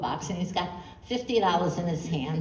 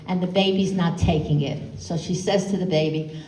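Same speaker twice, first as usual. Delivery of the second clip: usual speaking voice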